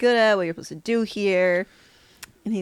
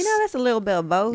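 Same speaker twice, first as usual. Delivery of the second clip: twangy accent; 4 semitones higher, 4 dB louder than usual. Real, not acted. Guest showing off their boots to the hosts, twangy accent. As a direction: let a strong twang into the vowels.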